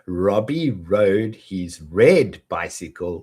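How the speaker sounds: slow, careful longer twister; slowly. Shown as a demonstration. Teacher modelling the twister slowly for shadowing. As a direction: take each rolling R slowly. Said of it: "we'll do it slow at first".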